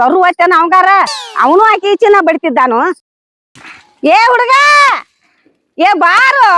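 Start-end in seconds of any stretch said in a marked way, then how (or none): 4.0-6.5 raised calls to someone far away; hollering. Acted. Mother hollers for her unhearing son, calling out. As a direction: call out to a boy who won't hear. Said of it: "ಒದರಿದ್ ಒಟ್ಟ ಕಿವಿಗೆ ಬೀಳುವಾತ್ ನೋಡು".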